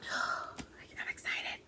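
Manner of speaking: slow, drawn-out whisper of excitement. Real, not acted. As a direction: breathe it out slowly in awe.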